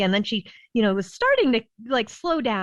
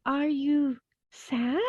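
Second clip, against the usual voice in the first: gentle question; 6 semitones higher, 4 dB quieter, much slower than usual. Retold or imitated. Mother gently asking upset daughter.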